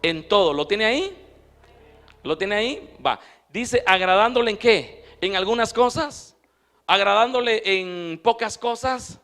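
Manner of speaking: loud, fervent pulpit delivery; full-force preaching. Acted. Pastor preaching loudly to his congregation, fervent. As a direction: preach it out to the whole church.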